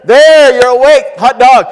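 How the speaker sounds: a triumphant rally cry; excited cheer. Real, not acted. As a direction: crow that they finally woke up. Rousing a sleepy audience.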